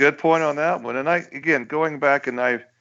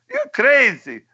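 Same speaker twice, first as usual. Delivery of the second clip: high, indignant mock dictator; cartoon Pol Pot voice; 9 semitones higher than usual. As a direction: squeal it like a cartoon tyrant. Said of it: "He looks at him like".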